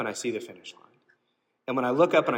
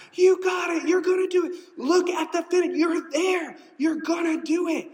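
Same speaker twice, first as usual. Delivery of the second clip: very high, loud shouted cheering; letting loose cheering; 4 dB louder than usual. Retold or imitated. Friend at the finish line screaming for the runner, very high loud shouts. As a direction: scream encouragement at a tired runner. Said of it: "and the whole time".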